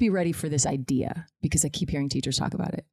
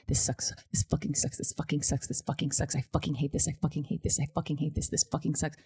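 muttered griping; 5 dB quieter than usual. Retold or imitated. Grumbling alone in the car at a red light, muttered. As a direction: re-enact griping under your breath.

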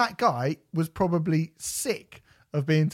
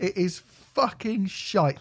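slow, fucking hit hard; emphatic verdict; 5 semitones higher, slower than usual. Real, not acted. Host repeats his disgust at the album. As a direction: spit out shite slowly. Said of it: "emphatic verdict".

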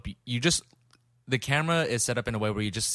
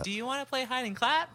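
much higher, sing-song ghost voice; high eerie voice; 14 semitones higher, 4 dB quieter than usual. Shown as a demonstration. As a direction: lilt it up high like the film ghost. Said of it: "Instead of, like".

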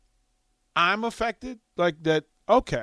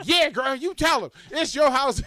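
high, sassy egging on; cheering girlfriend; 9 semitones higher than usual. Retold or imitated. Backing the stranger against her ex, high and sassy. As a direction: hype her up, much higher. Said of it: "And I'm just like".

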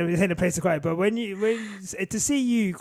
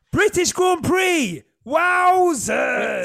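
higher, louder, slow topic call; excited announcement; 8 semitones higher, 6 dB louder, much slower than usual. Real, not acted. Announcing the next topic to his co-hosts, excited. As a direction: announce the Grand Prix with glee.

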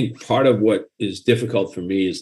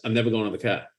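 quick, softer Boston accent, r dropped in car; fast Boston accent; 6 dB quieter, faster than usual. Shown as a demonstration. Writer demonstrating the proper way, quick Boston accent. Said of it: "It's fast. It's quick".

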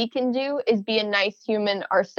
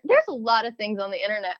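ordinary delivery

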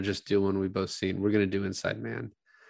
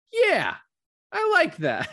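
much higher, louder voiced woman; mock eager female voice, pitched way up; Sylvia's eager yes; 6 dB louder, slower than usual. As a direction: squeal it like an eager bank teller. Sylvia voiced agreeing to Algeria.

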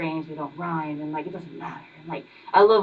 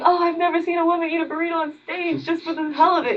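mocking high voice; 11 semitones higher than usual. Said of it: "why are we just like".

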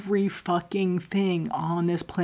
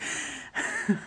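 short breathy laugh; real laugh; 8 dB quieter than usual. Real, not acted. Speaker breaking off over oversharing, short laugh. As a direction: laugh briefly at yourself. Laughs at her own tangent.

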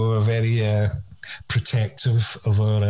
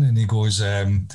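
usual speaking voice

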